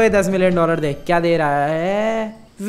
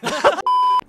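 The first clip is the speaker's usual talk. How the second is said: high squealing laugh; burst of laughter. Real, not acted. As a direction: crack up at the joke. Laughs at the game's voice. (ऊँची खिलखिलाती हँसी)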